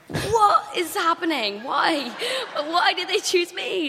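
high, drawn-out bewilderment; incredulous, pitched well up; acted disbelief. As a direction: stretch it out, baffled and high-pitched. Acting out her disbelief.

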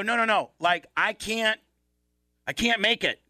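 high-pitched retold panic; imitated claustrophobic protest, high and insistent; freaking out. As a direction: act out a panicking patient, pitched well up. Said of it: "I'm freaking out".

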